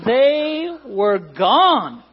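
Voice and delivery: high, loud, very slow; swooping, elongated storytelling punch; drawn-out punchline. Real, not acted. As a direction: stretch each word for comic emphasis. Punchline of his sneeze story.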